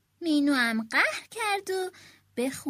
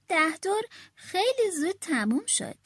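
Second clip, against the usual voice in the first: plain reading voice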